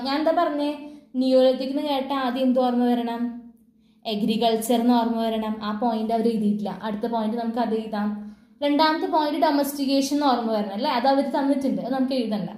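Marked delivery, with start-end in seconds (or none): none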